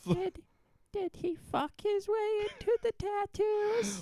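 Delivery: high squeal of disbelief; incredulous squeak. Real, not acted. Incredulous high-pitched question.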